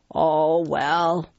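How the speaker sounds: resigned lion voice, a careless shrug; shrugging lion. Acted. Lion giving up on his small meal, shrugging. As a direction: let it go with a verbal shrug. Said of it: "he shrugged".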